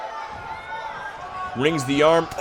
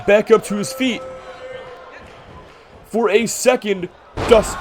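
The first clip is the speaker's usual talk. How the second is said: play-by-play building to a high peak; wrestling commentator; 4 semitones higher than usual. Acted. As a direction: call the near fall with rising hype. Live play-by-play builds to near fall.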